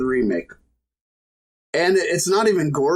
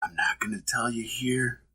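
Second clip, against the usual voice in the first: whisper; 5 semitones lower, 6 dB quieter, slower than usual. Pretend. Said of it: "conspiracy tease, hushed".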